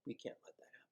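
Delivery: whispered repeat under the breath. Real, not acted. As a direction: breathe it again as a hushed secret. Repeats line then whispers.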